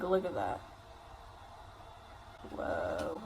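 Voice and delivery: ordinary delivery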